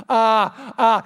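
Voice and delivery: startled, loud stammering hesitation; flustered doctor stammer; nervous stammer. Retold or imitated. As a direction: caught off guard and flustered. Doctor stammering when accused.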